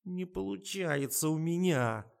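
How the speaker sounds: high, drawn-out whine; whining. A narrator's reading. Tasya whines that she can't manage it, whining. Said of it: "Тася заныла".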